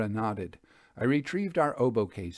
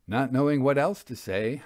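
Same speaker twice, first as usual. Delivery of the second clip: ordinary delivery, nothing marked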